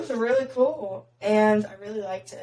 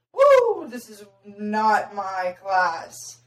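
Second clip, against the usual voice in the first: mock alarm; 6 dB louder, much slower than usual. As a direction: act out a drawn-out realisation of a blunder. Retold realisation of wrong class.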